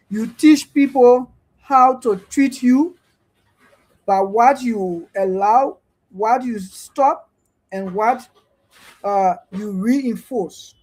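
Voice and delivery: each phrase slowed and weighted; slow emphatic quote. Real, not acted. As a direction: read it out slowly, like a saying. Reciting her own posted quotation.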